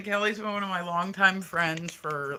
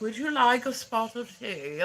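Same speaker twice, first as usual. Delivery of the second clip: higher, louder put-on posh British accent; mock posh British accent; 6 semitones higher, 5 dB louder than usual. Imitating her friend's posh British accent to viewers. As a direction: play a prim Englishwoman offering tea in British accent. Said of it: "This bitch sounds like Mary Poppins".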